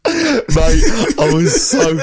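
mock emotional, voice cracking; like an Oscar acceptance speech; voice breaking. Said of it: "Your voice was going, thought you were accepting an Oscar".

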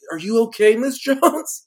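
high, hammy concerned hero voice; bad acting. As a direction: overplay the worried leading man. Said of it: "being like".

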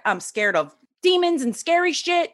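high, whiny protest; mock scared refusal. Refusing to be a healer to coach, high mock-scared whine. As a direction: play your younger self squirming out of it. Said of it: "And I'm like".

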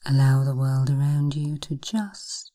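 ordinary talk, nothing marked

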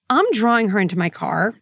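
usual speaking voice

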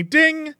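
high sung-out ding; elevator ding. Retold or imitated. Elevator arriving at his floor, bright ding imitation. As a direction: chime like an elevator bell.

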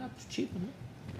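slow, soft, near-whispered shrug of a line; mock indifferent reply. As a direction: hand it back slow and unbothered, barely voiced. Germán's unbothered reply, retold.

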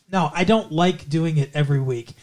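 usual speaking voice

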